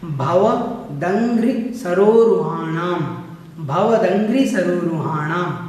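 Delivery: भवदंघ्रि सरोरुहाणाम slowly, each part set apart; slow pronunciation. Shown as a demonstration. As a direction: separate भव, दंघ्रि and सरोरुहाणाम with slight breaks. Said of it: "भव अलग करना है, दंघ्रि अलग करना है".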